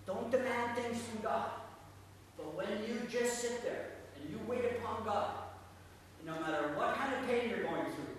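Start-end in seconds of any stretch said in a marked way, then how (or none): none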